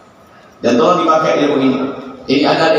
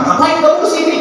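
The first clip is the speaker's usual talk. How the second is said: high, sharp mock-angry "why are you here"; angry snap. Debtor caught counting money snaps at the creditor, mock angry. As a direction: bark it at the unwelcome caller.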